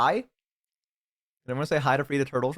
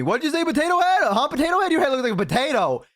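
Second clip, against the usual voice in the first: mocking taunt; 9 semitones higher than usual. Streamer retells taunting the bully in VR chat, mocking. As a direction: throw it back at her teasingly. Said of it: "turned the tables on her and went".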